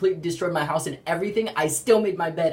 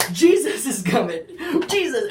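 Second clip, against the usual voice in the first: louder, laughing with excitement; excited laughing; 6 semitones higher, 4 dB louder than usual. Retold or imitated. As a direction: shout it out gleefully with a laugh. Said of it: "we're like".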